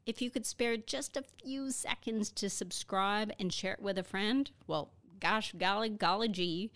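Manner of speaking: lightly voiced gee-whiz kid; golly-gee cheerful outro; wholesome 1950s kid. Recorded outro, chirpy host voice.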